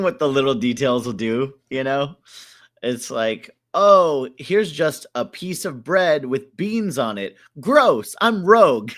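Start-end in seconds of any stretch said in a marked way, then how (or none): 3.7-8.9 loud, exaggerated mock disgust; hammed-up revulsion. Host mocking beans on bread, hammed-up disgust. Voices reaction to plain bean toast.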